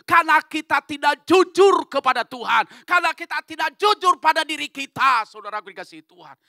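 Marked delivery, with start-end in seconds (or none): 0.1-5.3 shouted high, driving the answer home; forceful preaching. Real, not acted. Preacher telling congregation they are not honest, forceful preaching. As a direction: thunder it at the congregation.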